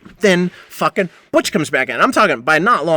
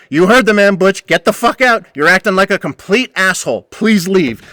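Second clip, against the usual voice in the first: firm boss; 5 semitones higher, 5 dB louder than usual. Retold or imitated. Loren voiced backing narrator.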